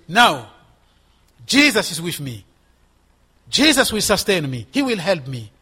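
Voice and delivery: loud, emphatic preacher's proclamation; bold declaration. Acted. Believer waking up and defying the enemy, loud bold declaration. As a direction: declare it forcefully, each phrase set apart by a pause. Acted defiant declaration of faith.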